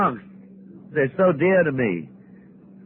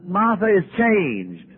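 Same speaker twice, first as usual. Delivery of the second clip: usual speaking voice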